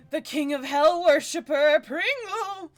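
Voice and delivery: loud, high grand proclamation; excited announcement. Retold or imitated. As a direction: announce it with a dramatic flourish. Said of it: "Petravian being more like".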